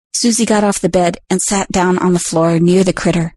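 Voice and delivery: ordinary delivery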